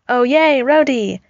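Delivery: Tony hammed-up delight at seeing Rhodey; mock delight. Tony greets Rhodey pinned to fridge, mock delight.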